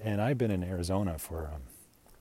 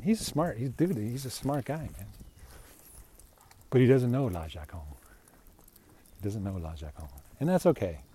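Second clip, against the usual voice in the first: ordinary talk, nothing marked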